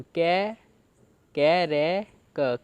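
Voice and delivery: very slow, each syllable apart; teacher breaking the word into slow syllables. Shown as a demonstration. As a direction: spell it out slowly for students. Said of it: "इसे हम पढ़ते हैं तोड़-तोड़ के".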